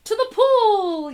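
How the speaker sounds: fireman yelling. Acted. Fireman yelling the destination to the boy, loud slow yell. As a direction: call it out slowly like a fireman. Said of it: "yelled the fireman".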